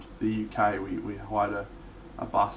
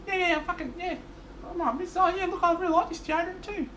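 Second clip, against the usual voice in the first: very high, excited mate's impression; high-pitched goofy mate voice; the Blythe voice. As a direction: squeak it out like a clueless, delighted bloke. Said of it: "We just used to do the Blythe voice".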